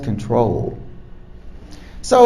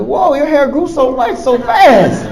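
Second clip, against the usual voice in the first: higher, laughing surprise; mock surprise; 8 semitones higher, 5 dB louder than usual. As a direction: act amazed at her hair, chuckling. Noticing her new long hair.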